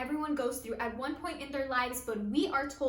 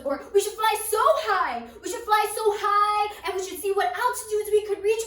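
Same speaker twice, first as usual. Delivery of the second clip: mounting excitement; 5 semitones higher, 8 dB louder than usual. Acted. One blackbird corrects the other, increasingly excited. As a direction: get carried away by a soaring idea. Blackbird acted, building to grand idea.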